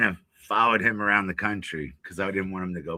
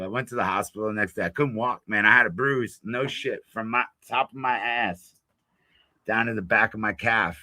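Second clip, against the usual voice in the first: usual speaking voice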